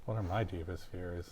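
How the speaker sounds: normal talking voice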